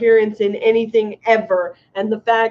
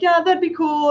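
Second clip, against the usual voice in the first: put-on Canadian kid; 8 semitones higher than usual. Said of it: "the Canadian kids are like".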